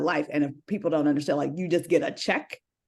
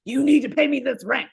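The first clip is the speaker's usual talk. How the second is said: higher, stern demand; dragon lady; 8 semitones higher, 4 dB louder than usual. Retold or imitated. To roommates demanding rent, mock stern. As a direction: insist like a strict landlady. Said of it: "dragon lady in my household".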